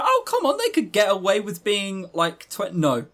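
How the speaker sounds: normal talking voice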